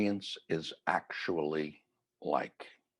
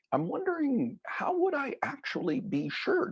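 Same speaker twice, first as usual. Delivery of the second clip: higher, lightly voiced young woman asking shyly; a hint of a nervous young caller, much higher; mock young caller. Caller asks Doctor Ruth.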